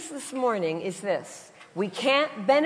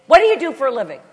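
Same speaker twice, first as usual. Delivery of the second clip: loud, raised, rapid-fire question; intense New Yorker; 4 semitones higher, 9 dB louder, much faster than usual. Retold or imitated. New York passenger asking about her job, imitated intense fast talk. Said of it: "They talk really fast".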